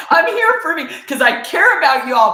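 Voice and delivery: loud and high, defiant; bold declaration. Retold or imitated. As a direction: announce it to the whole room. Declaring to the group in prison.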